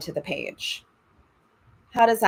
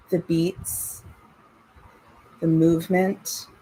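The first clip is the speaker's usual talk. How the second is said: calm, unhurried breathing guidance; guided meditation. Acted. Leads breathing and body scan.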